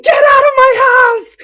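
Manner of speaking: high, loud screamed order; a furious high shout at the worker; retold scream. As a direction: scream them out of the house. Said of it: "and I just started screaming".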